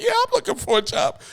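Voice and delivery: high keen agreement; eager. Retold or imitated. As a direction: jump at the offer. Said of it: "but I was like".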